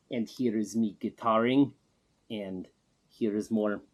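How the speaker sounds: broken English in a put-on Russian accent; Man Gaga's Russian accent. Man Gaga introduces himself to the interviewer in a put-on Russian accent. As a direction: play a self-styled star with a thick fake Russian accent. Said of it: "The first thing I notice is the accent".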